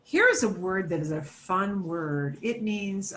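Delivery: ordinary talk, nothing marked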